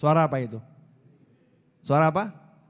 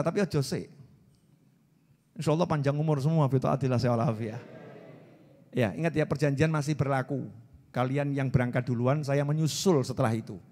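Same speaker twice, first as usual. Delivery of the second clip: normal talking voice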